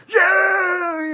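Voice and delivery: high, loud shout of joy; so excited. Retold or imitated. Natsu learns his guild is last and shouts, fired up and excited. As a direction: yell it like you're all fired up. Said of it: "he's so excited".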